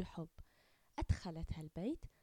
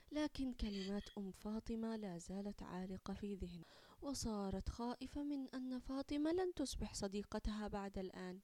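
usual speaking voice

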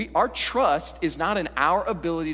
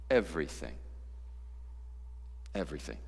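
low, soft "everything"; quiet, weighty repetition; hushed emphasis; 12 semitones lower, 14 dB quieter, faster than usual. Real, not acted. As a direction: let each word drop and sink in. Answers own question with hushed repetition.

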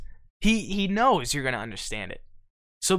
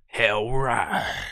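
low [name] impression; 6 semitones lower than usual. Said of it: "And they go".

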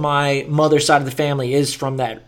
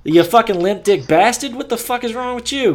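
higher, hammed-up Boston insults; mock Boston street tirade; Boston tough guy rant; 9 semitones higher, 3 dB louder than usual. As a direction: play an angry Boston local cussing someone out. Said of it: "or you'd be like".

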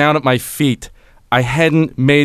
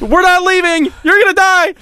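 high-pitched mock shouting threats; threatening kid; 13 semitones higher, 4 dB louder than usual. Attackers outside the house demand he come out, shrieky mock threats. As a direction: imitate a kid yelling death threats through a door. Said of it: "And then I just hear".

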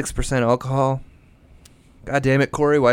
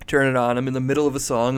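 ordinary delivery

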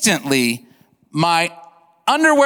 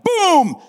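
high, booming shotgun imitation; gunshot sound; 11 semitones higher than usual. Hunter telling of his first shot, imitated boom.

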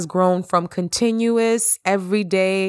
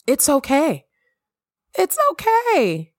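reassuring; 7 semitones higher than usual. Real, not acted. Reassuring listener, high pitch.

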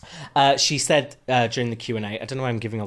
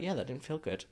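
quiet aside; 2 semitones higher, 12 dB quieter than usual. Retold or imitated. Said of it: "I was like".

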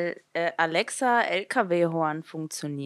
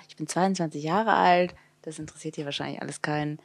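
ordinary talk, nothing marked

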